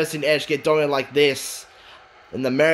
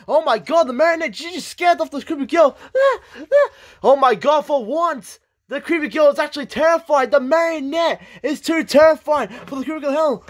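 high, incredulous commentary; astonished wrestling commentator; amazed play-by-play; 10 semitones higher than usual. Acted. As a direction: react in delighted disbelief at the scare. Live play-by-play, girl scared off.

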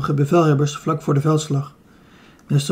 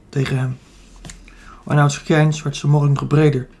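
ordinary delivery, nothing marked

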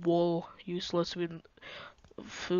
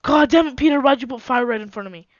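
loud frustrated outburst; angry shout; 2 semitones higher, 6 dB louder than usual. Real, not acted. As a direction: yell at your friend. Set on fire by teammate.

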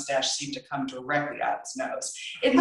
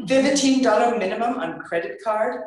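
raised, louder incredulous question; disbelieving echo; 7 semitones higher, 5 dB louder than usual. Acted. Girl echoing the clerk's rule, incredulous. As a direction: repeat it back in disbelief.

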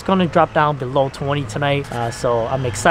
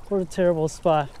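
ordinary talk

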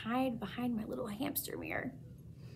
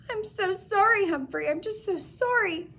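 tender apology; 9 semitones higher, 3 dB louder than usual. A narrator's reading. Comforting the hamster.

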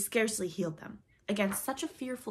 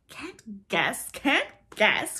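loud, gleeful taunt, climbing higher; crowing; 4 semitones higher, 9 dB louder, much slower than usual. A narrator's reading. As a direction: crow over the puzzled pirates. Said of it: "crowed Peter".

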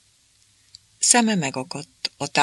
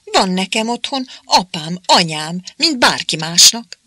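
higher, indignant protest; Márika defending her family, indignant and higher; 4 semitones higher, 5 dB louder than usual. A narrator's reading. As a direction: insist proudly, still offended. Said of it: "méltatlankodás".

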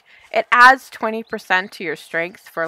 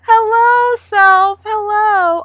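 cutesy greeting; 12 semitones higher, 7 dB louder, much slower than usual. Real, not acted. Greeting a rabbit.

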